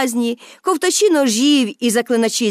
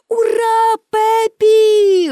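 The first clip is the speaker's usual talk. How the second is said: high, bright cheer, a bit louder; 10 semitones higher, 4 dB louder, much slower than usual. A narrator's reading. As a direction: cheer your friend on, pitched up. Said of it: "гукнули Томі й Аніка". (радісно вигукують ура)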